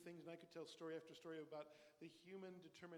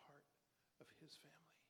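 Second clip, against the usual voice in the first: whisper; 3 semitones lower, 14 dB quieter than usual. Real, not acted. Sermon point dropped to whisper.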